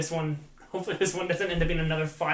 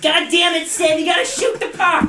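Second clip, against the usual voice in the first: much higher, much louder frustrated bench yell; Phil Kessel impression; 14 semitones higher, 11 dB louder than usual. Podcaster imagines the player coaching Sid, yelling high and loud. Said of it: "I can't imagine taking his voice".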